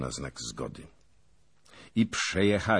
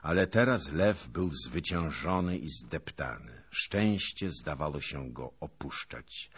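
ordinary delivery, nothing marked

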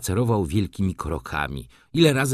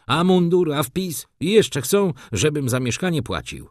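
louder, higher bursts of irritation; irritated; 8 semitones higher, 3 dB louder than usual. A narrator's reading. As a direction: rant it out, fed up. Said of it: "mówił zirytowany".

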